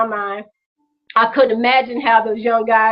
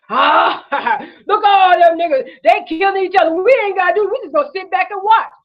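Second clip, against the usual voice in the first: higher, laughing mock bigot voice; 6 semitones higher than usual. Mocking racists gloating over violence.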